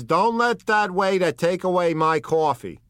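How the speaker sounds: un boss di strada che racconta, alzando la voce; Wolfsheim. Acted. Wolfsheim retells Rosie.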